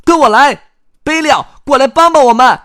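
high, forceful chick shout; loud calling out. Acted. Chicks call Belio over to help, loud high shouting. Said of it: "小鸡们大声喊".

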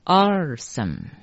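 Arsan run together as "ar-san"; syllables joined together. Shown as a demonstration. Teacher showing three characters read as one, joined pronunciation. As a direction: blend Arsan into two linked syllables. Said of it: "三个字连起来念".

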